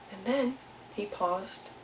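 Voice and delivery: plain reading voice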